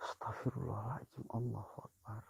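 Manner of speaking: hushed, breathless prayer under his breath; frightened whisper. Real, not acted. Ghost hunter praying to himself in the dark, frightened whisper. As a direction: whisper it so nothing out there hears.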